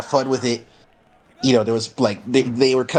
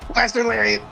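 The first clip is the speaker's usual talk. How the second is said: high, excited ringside call; excited move call; 9 semitones higher than usual. Real, not acted. Calling the lariat a second time, excited. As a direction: pitch it way up as the move hits.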